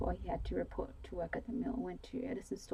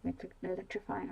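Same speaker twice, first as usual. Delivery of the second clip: usual speaking voice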